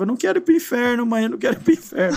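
put-on child begging his mother, afraid of hell; scared child pleading. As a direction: rush it out like a terrified kid. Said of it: "Eu falava assim".

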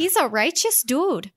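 put-on Minnesota accent, slow and high; a slow Midwestern drawl on the line. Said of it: "Midwestern slash Minnesota, Wisconsin accent".

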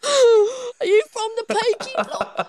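high, slow, exaggerated British accent; hammed-up British accent. Riffing on Peaky Blinders, caricature British accent. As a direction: stretch out a silly British accent, near laughing. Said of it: "we're gonna stop with the accents".

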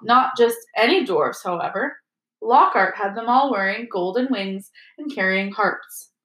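ordinary delivery

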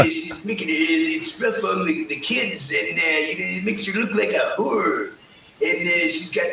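imitated fumbling phone caller, full of uhs; Stu Hart. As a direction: mumble through a complaint in a put-on voice. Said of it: "Yeah, Stu, uh, what are you doing".

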